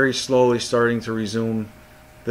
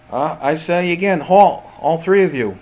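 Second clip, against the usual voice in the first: higher, louder, slow order to halt; commanding guard; 5 semitones higher, 3 dB louder, slower than usual. Acted. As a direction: call out a firm, drawn-out command. Game master voices guard ordering halt.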